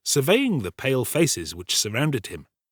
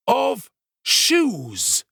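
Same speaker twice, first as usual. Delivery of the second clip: "shoes" drawn out high and weighty; great emphasis; 13 semitones higher, 5 dB louder than usual. A narrator's reading. Giles correcting the tinker's interruption, great emphasis on "shoes". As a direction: lean hard on "shoes", after a pause. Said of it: "laying great emphasis on the word".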